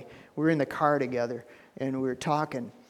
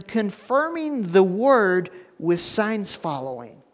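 full-force preaching; 7 semitones higher, 6 dB louder than usual. Real, not acted. Pastor preaching the good news to the congregation, full-force loud high preaching. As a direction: lift the room with it, building to a cry.

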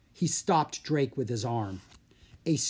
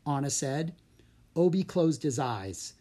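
usual speaking voice

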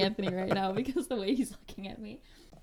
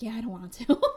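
laughing through the words; giggling reply; 3 dB louder than usual. Retold or imitated.

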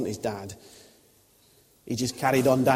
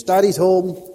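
high, loud joyful call; excited child shout; 8 semitones higher, 12 dB louder, slower than usual. Retold or imitated. Toddler greeting his dad at the door, a high loud shout. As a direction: yell it like a toddler hearing dad come in. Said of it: "and he shouts".

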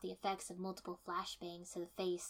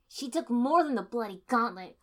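loud, high angry snarl; 4 semitones higher, 12 dB louder than usual. A narrator's reading.

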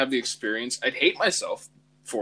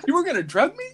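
high, loud mock shock; mock alarm; 5 dB louder, faster than usual. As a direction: squeak it out in fake horror. Mock alarm at being drugged.

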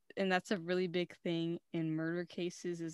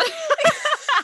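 squealing burst of laughter; loud high laugh; 14 dB louder than usual. Real, not acted. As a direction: shriek with laughter.

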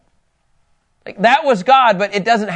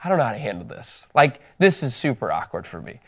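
low, soft aside to God; soft embarrassed murmur; hushed prayer; 7 semitones lower, 6 dB quieter than usual. Retold or imitated. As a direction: quietly confess the awkwardness. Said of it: "'cause I was like".

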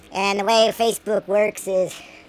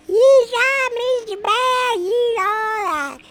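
very high, put-on fan voice; high squeaky fan, slowing at the end; 13 semitones higher, much slower than usual. Imagined audience answers bear.

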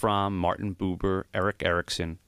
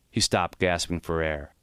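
ordinary delivery, nothing marked

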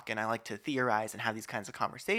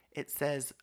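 ordinary talk

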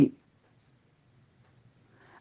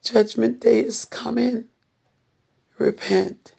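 urgent pleading preacher, pressing hard; doomsday street preacher; 4 semitones higher than usual. Acted. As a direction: plead with them to repent now.

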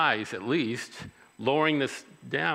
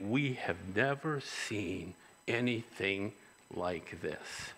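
hushed awe; 3 semitones lower, 6 dB quieter, slower than usual. Retold or imitated.

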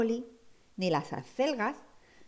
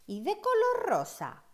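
high wondering question; 12 semitones higher than usual. Acted. Child wondering aloud.

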